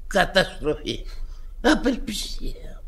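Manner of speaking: breathy mock-tragic whisper; a theatrical whisper of despair. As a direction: whisper it like a great loss. Drunk mourning broken wine.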